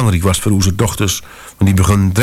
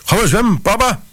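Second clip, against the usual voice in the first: put-on high, eager toddler voice, much higher; small child asking to go swimming; excited little girl calling; 14 semitones higher than usual. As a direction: call out like a keen little daughter. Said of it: "riep de oudste Bliede".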